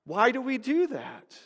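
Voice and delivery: ordinary delivery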